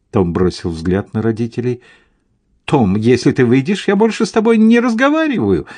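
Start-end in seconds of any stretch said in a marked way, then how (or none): 2.7-5.7 climbing into a high, hurried threat; warning threat. A narrator's reading. Mother to Tom as he considers getting out, warning threat. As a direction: warn him with rising indignation. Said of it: "предупредила мать".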